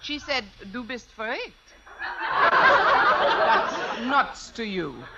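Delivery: play-acted German accent; put-on German accent. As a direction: pretend German woman on the phone. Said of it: "If I can do a decent German accent".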